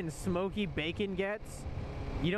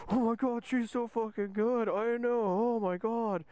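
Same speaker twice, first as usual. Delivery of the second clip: higher-pitched, drawn-out fangirl gushing; mock gushing fan; 5 semitones higher, slower than usual. Drunk friends listening to [name], mock gushing. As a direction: swoon over your favorite singer. Acting out drunken fans of singer.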